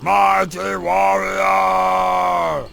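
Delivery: deep, slow booming bellow; strongman. Acted. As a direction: boom it out like a brute. Warlord names the hero.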